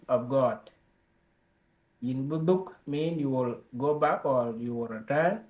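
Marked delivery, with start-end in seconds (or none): none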